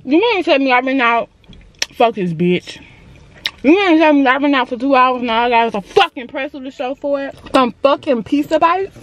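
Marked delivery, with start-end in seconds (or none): none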